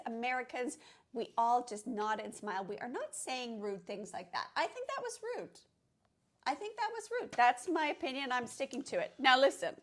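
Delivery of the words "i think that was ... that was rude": high, indignant insistence. Real, not acted. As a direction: protest the rudeness, pitched up. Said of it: "You're so angry?".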